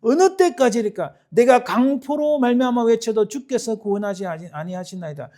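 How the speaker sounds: high, loud cry of protest to God; loud, high, impassioned plea; angry outcry. Acted. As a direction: cry out in indignation, tailing off at the end. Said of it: "분노가 치밀어 일어나서 막 외치는 거예요".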